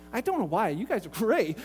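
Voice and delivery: normal talking voice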